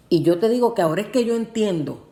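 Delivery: ordinary talk, nothing marked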